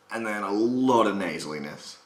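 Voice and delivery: ordinary delivery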